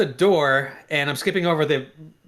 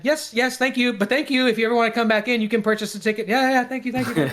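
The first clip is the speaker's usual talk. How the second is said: hammed-up polite; 7 semitones higher than usual. Over-polite retreat after being caught.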